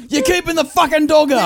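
high mock shout; irate dad. Dad blames son for waking the dog, mock shouting. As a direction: imitate an exasperated father hollering. Acted rant of the father.

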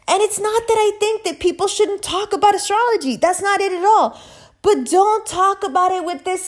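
high-pitched, insistent complaint; exasperated protest. Real, not acted. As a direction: push it high, sustained exasperation. Exasperated rant at fake authorities.

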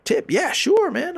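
high, disbelieving squeak; incredulous. Retold or imitated. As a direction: feign a startled yes. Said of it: "And I'm like".

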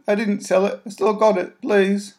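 put-on a caught child owning up; boy's whisper. Boy confessing to Mary, high and pleading. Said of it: "he whispered".

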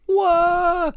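high, loud startled what; shocked. Acted. Acting shocked response.

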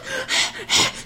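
high, breathy panic breath; high panicked gasp. Retold or imitated. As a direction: gasp like she's freaking out. Imitated breathing.

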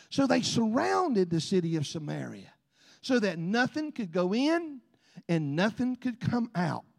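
ordinary talk

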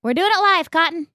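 high, loud mock announcement; mock live announcement. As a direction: declare it like a TV host.

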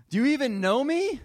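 loud, high, indignant wife; hurt retort. Retold or imitated. Wife confronting husband about the Mother's Day tickets, loud and high. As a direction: throw the question back in outrage.

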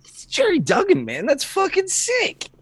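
high, thrilled about the news; excited. Real, not acted. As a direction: gush over the new writer, slowing on the last words. Excited about new writer.

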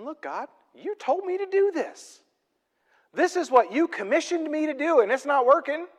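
high-pitched exasperated protest; frustrated. Acted. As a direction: complain to God as a frustrated Moses. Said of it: "almost hear the frustration in Moses' voice".

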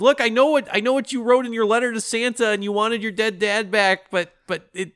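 high-pitched, hurried excuse-making; hammed-up frantic explaining; mock pleading. Acts out a desperate plea.

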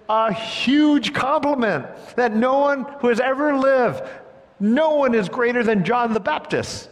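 high, loud, swelling with wonder; excited emphasis. Real, not acted. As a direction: preach it out with awe. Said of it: "Wow, what an affirmation".

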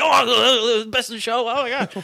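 high, loud, giddy fanboy gush through laughter; starstruck gushing. Retold or imitated. Barista meeting Parker Posey, starstruck and laughing. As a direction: gasp out titles like a starstruck fan. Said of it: "I'm like".

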